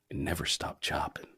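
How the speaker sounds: hushed sign-off whisper; whispered tagline to the listeners. Acted. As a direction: breathe the catchphrase out in a whisper. Whispered podcast sign-off catchphrase.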